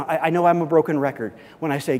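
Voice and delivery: usual speaking voice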